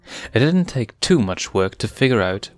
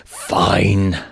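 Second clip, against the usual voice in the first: furious rasp; 3 dB louder than usual. Acted. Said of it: "he rasped furiously".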